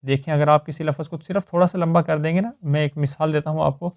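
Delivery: usual speaking voice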